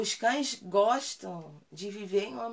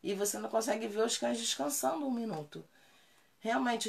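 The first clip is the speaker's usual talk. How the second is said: ordinary talk, nothing marked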